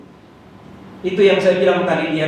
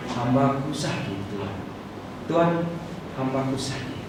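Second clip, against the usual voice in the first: humble plea; 4 semitones lower, 10 dB quieter than usual. Retold or imitated. Officer begs Jesus quietly for his sick servant. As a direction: plead softly, head bowed.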